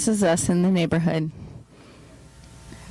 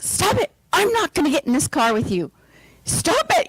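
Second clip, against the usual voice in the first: mad yelling; 11 semitones higher than usual. Retold or imitated. Wife refusing to ride with husband, high angry shout. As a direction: scream it like you're about to jump out. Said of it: "Getting really mad".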